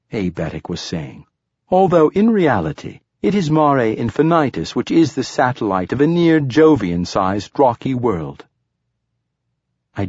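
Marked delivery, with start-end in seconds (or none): 1.7-8.5 higher, louder precise android; lightly voiced formal android. A narrator's reading. Android speaks.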